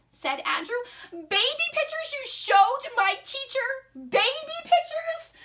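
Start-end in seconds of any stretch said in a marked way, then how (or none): 1.1-5.3 put-on high-pitched incredulous child; appalled protest. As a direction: accuse mom in high disbelief. Andrew protesting to mom.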